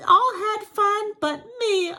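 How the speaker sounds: high, loud, put-on whiny bear; whimpering bear wail. Bear complaining his guests had all the fun, acted whimpering wail. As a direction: wail it like a hard-done-by bear. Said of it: "he whimpers and he moans and he wails".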